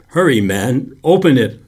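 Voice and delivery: loud, high urgent cry. A narrator's reading. Boy cries out at the fumbling lifeguard, loud and high. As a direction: shout at him to hurry. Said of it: "I cried".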